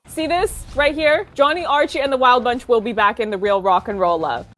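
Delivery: ordinary delivery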